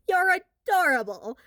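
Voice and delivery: high, loud sarcastic coo; mocking. A narrator's reading. Bully sneering at the boy's bread attack, sarcastic. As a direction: sneer it sweetly.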